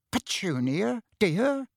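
small, tentative, rising question; timid. A narrator's reading. Uncle approaching his silent wife, timidly. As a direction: venture it carefully, pitch lifting at the end. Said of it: "said Uncle Vernon timidly".

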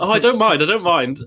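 put-on high, eager drunk girl voice; a lightly hammed-up eager drunk stranger; mock drunk girl.

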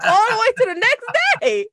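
giggling through the words; laughing. Real, not acted. Laughing at runaway character.

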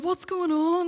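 high, loud retold question; woken girls' alarm. Other girls wake in the hotel room, high loud bewilderment. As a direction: call out like a puzzled room-mate. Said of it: "the other girls being like".

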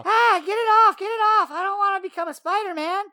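frantic get it off; scared kid yelling about a spider; panicked. Acted.

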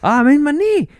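loud, very high mock wail; Doris faking pain. Passenger caught out on her injury, loud fake pained voice. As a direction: squeal it like a badly faked injury. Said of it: "this seems fake as fuck".

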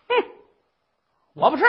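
loud, high sneering demand; the bossy mother-in-law finds fault; haughty old mother. Acted. Old mother-in-law sneers at the rice, loud and high.

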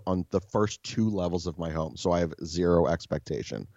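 usual speaking voice

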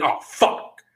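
usual speaking voice

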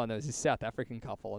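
ordinary talk

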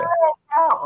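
high, loud announcer-style yell; show-title shout. Pretend. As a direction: belt out the show name.

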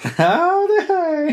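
very high, very slow howdy; cowboy greeting. Pretend. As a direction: stretch it out like a cartoon cowboy.

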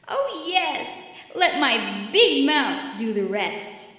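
slow, loud, greedy gloat; gloating monster. Acted. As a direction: savor the trap. Big mouth gloats.

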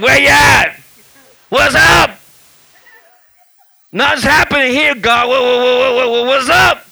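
high, loud confrontational challenge; mock belligerent hollering. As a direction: re-enact calling God out, street style.

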